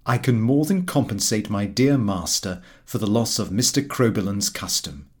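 usual speaking voice

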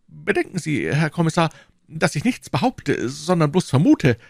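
high, plaintive shopkeeper voice; anxious prisoner. Acted. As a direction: plead your case, pitched high. Prisoner cautiously hedges to the commissioner.

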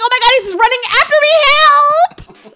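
put-on high, fast girly panic; panicked teen girl. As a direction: squeal it like a scared teenage girl. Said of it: "And he's like".

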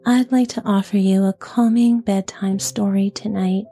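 soft, gentle whisper; soft bedside voice. Real, not acted. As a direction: whisper it gently, like tucking someone in. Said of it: "with a nice, soft, gentle voice".

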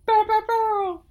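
squeaky high-pitched cheer; high hype cheer. Pretend. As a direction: bark it out high and loud.

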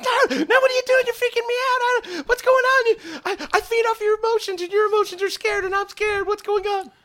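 very high, frantic critter voice; freaking-out creature. Acted. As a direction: squeal and whine in rising panic, like a scared pet. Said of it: "Kokolo is now kind of freaking out".

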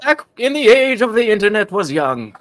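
a hint of a creaky grandpa; old man voice. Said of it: "an old man voice".

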